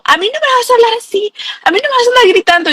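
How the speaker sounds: high mocking voice of the aggressor. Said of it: "y entonces me dijo". (imitando con burla la voz alta de la otra persona)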